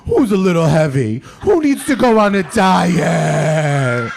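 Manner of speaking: loud, high, slow mocking coo; teasing the now-female dog about her weight, loud and high; doggy baby talk. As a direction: tease the dog in a booming sing-song. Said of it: "talking to the dog again".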